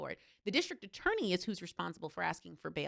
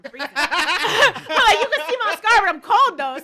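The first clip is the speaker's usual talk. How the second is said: high, loud laughing complaint; 13 semitones higher, 18 dB louder, slower than usual. Real, not acted. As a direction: laugh through the words. Laughs about being cold.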